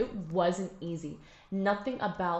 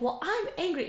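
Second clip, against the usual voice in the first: retold angry reply; 11 semitones higher than usual. Speaker answering the Israeli girl in dialogue, high indignant retort. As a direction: snap back with raised pitch. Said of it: "and I'd be like".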